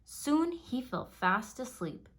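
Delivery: ordinary delivery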